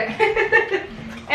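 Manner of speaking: airy high burst of laughter; laughing. Real, not acted. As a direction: let out a real breathy laugh. Laughs as kids take more pete.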